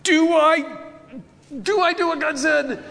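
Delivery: high, strained panicky question; acted panic. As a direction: blurt it out in a mock sweat of panic, halting and restarting. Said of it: "I can't imagine the panic, sweat".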